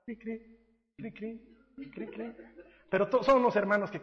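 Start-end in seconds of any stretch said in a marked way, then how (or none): 0.1-2.4 soft mock cricket chirping; cricket chirps. Pastor mocking the silence after a volunteer call, soft cricket chirps.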